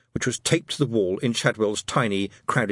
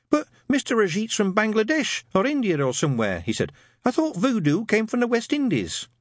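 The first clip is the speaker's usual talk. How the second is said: put-on much higher mild young voice; a meek, polite recruit; timid young private; 11 semitones higher than usual. A narrator's reading. Newt asking his sergeant, meek and higher.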